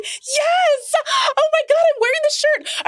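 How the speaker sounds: very high, near-shrieking with joy; thrilled squeal. Retold or imitated. Answering the delivery woman about her Thor shirt, squealing. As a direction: squeal like a fan caught in her merch. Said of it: "I'm so excited".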